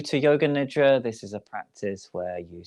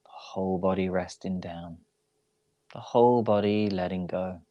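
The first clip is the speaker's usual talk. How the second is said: guided meditation. Real, not acted. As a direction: ease them into rest, unhurried and even. Leads a yoga nidra relaxation.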